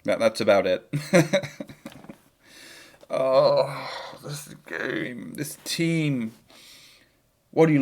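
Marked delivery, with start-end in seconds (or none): 0.9-1.6 short high-pitched giggle; amused little chuckle. Real, not acted. Laughs at own Scouse attempt. 3.1-6.3 long weary groan into words; breathed-out, drawn exasperation; exasperated sigh. Real, not acted. Despairing at the football match.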